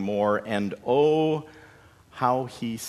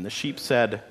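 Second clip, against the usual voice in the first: ordinary delivery, nothing marked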